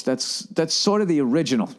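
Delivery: ordinary talk, nothing marked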